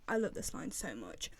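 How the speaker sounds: ordinary delivery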